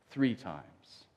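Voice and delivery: ordinary talk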